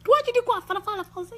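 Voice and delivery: high, loud mocking yell; retold shouting. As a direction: shout it back at her in mock outrage. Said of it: "il dit".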